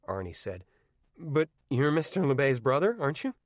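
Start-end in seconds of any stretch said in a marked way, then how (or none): 1.2-3.3 put-on lightly higher, polite young voice; a hint of a nervous teenager; teen voice. Arnie politely greets the dead man's brother, lightly voiced teen.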